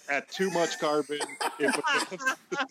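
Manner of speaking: high, giggly words through laughter; laughing. Real, not acted. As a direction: laugh while you explain. Explaining while laughing.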